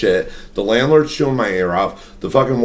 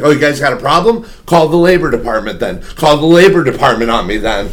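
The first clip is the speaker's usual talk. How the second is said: raised, shouted imitation of an angry boss; mock shouting manager; 4 semitones higher, 4 dB louder than usual. Teller mimics the Dunkin' warden yelling at staff, loud mock rant. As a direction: yell it like a manager picking a fight. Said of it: "She's like".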